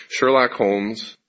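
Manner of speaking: normal talking voice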